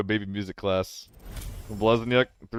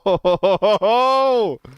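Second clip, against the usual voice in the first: relieved laugh; 9 semitones higher, 6 dB louder than usual. Real, not acted. Relief after stressful raid.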